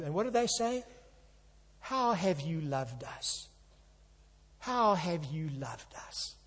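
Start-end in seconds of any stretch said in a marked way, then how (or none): 1.8-6.3 soft, slow, doubting question; sad reply. Retold or imitated. Preacher voicing Israel's weary answer to God, sad. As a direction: let the doubt sag wearily. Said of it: "What a sad reply".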